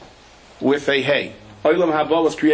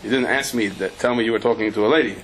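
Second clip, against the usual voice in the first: normal talking voice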